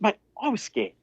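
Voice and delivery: mock scared. Teasing employee about scary message.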